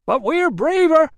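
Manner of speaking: high loud heckle; defiant shout. Acted. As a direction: shout it from the back. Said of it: "shouted a voice from the back".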